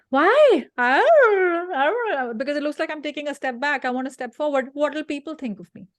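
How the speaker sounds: high, loud, fast anxious inner voice; hammed-up fretting; mock panic. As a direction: play the worried ego in a panic.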